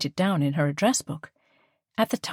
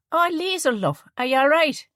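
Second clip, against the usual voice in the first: higher, homely northern Irish voice, caring; thick northern Irish accent; 10 semitones higher, 4 dB louder than usual. A narrator's reading. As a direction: back to her own broad accent with a friend. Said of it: "Immediately she was back to herself".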